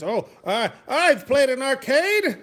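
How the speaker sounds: put-on high, loud, drawn-out whine of a kid; hammed-up youngster voice; mocking young kids. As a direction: mimic a boastful kid, slow and high. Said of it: "these young kids who says".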